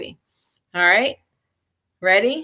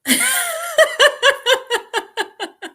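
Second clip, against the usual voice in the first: high, bubbling laugh; real laughter. Real, not acted. Caught smiling through the happy chant, laughing. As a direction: let the laugh spill out. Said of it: "can you say that and not smile".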